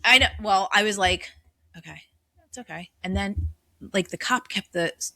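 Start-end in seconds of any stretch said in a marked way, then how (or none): 1.8-2.9 self-soothing murmur. Retold or imitated. Retelling panic on the bridge.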